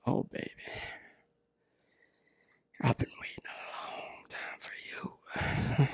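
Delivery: breathy seductive whisper. Pretend. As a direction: whisper it close as a creepy lover. Seductive line to captive woman.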